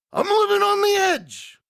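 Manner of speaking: crazed high wrestler's yell, easing after the first outburst; Macho Man. Acted. Wrestler answering doubts about his mental stability, crazed hammed-up shouting. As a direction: open on a huge high shout, stay wound up. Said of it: "he actually kinda got crazy here".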